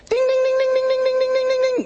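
imitated quiz bell ringing; game show bell. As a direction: sing out a winner's bell. Right answer bell imitation.

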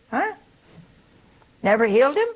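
ordinary talk, nothing marked

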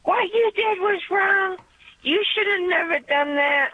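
high-pitched mock yell, no real volume; retold yelling. Caller retells her friend criticizing her, mock yelling. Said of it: "she just starts yelling".

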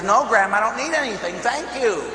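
high-pitched, firm brush-off; insistent refusal. Retold or imitated. Grandson refusing money his grandmother pushes on him, insistent.

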